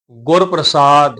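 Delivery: ਗੁਰ ਪ੍ਰਸਾਦ slow, with pr blended lightly; light half-r. Shown as a demonstration. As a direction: hold the long saad in ਗੁਰ ਪ੍ਰਸਾਦ, light r after p. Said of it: "ਉਚਾਰਨ ਥੋੜ੍ਹਾ ਜਿਹਾ ਹਲਕਾ ਹੋ ਜਾਏਗਾ". (ਗੁਰ ਪ੍ਰਸਾਦ ਵਿੱਚ ਅੱਧਾ ਰਾਰਾ ਹਲਕਾ)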